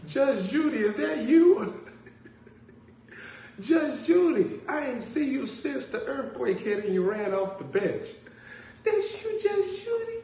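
put-on high excited old lady voice; mock starstruck lady. Old lady mistaking the preacher for Judge Judy, excited. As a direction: squeal it like a fan meeting a star. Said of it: "the lady said".

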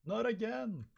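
high drawn-out yell; background cry of dismay. Acted. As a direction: wail in comic despair. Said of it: "in the background go".

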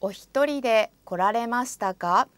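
ordinary delivery

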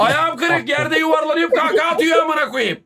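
words broken up by real laughter, pitch up; can't stop laughing as he tells it; laughing while talking. Real, not acted. Telling friends how his buddy laughed, laughing through it.

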